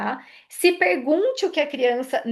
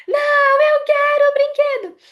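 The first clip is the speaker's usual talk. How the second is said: criança gritando agudo e alto, birrenta; 8 dB louder than usual. Shown as a demonstration. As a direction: grite como criança mimada em plena birra. Criança birrenta respondendo à mãe. (criança em birra gritando com a mãe, aguda e alta)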